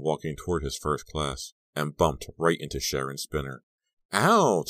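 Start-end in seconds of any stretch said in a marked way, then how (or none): 4.1-4.7 high, loud yelp of pain; yelled ouch. A narrator's reading. Girl bumped into in the hall, overdone yell. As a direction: overreact to being bumped. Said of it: "she yelled".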